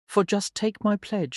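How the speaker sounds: usual speaking voice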